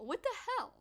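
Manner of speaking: high-pitched disbelief; exasperated. Real, not acted. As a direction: squeak it out in disbelief. Exasperated at dad's candy pile.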